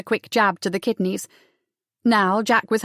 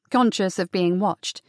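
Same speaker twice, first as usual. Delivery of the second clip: plain reading voice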